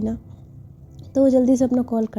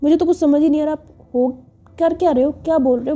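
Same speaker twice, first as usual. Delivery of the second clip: raised, louder exasperated retort; 5 semitones higher, 4 dB louder, faster than usual. Acted. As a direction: fire back at her childish fuss. Manya snaps back in quarrel.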